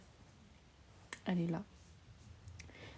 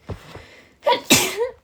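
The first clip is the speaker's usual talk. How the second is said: sudden loud sneeze; 14 semitones higher, 15 dB louder than usual. Real, not acted. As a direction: let the sneeze burst out. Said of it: "Je vous ai éternué dessus".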